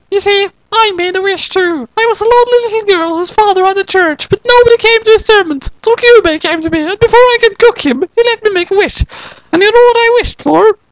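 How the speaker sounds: put-on a squeaky little girl confiding; high girl voice. Kyoko telling Sayaka her wish, high girl voice.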